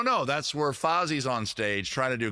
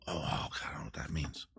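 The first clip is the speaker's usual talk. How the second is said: breathy whisper to himself, much softer; whispered aside; 8 semitones lower, 10 dB quieter than usual. Real, not acted. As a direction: drop to a whisper, half to yourself. Muttered aside off mic.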